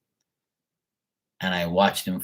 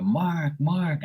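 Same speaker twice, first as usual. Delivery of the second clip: lightly birdlike mock call of a name; a hint of crow squawking the name; crows saying Mark; 6 semitones higher than usual. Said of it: "they're saying".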